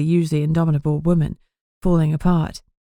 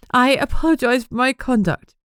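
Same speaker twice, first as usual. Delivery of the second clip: stiff; 8 semitones higher than usual. Acted. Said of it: "she said stiffly".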